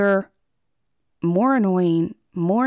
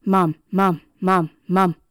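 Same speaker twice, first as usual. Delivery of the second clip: lightly imitated pestering child, louder; Stewie; 4 dB louder than usual. Cartoon son pestering his mama for attention, lightly imitated nagging. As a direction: repeat it insistently in separate beats, a hint of nagging kid. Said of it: "just like Stewie from the Family Guy, who drove his mama crazy".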